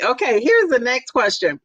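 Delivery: usual speaking voice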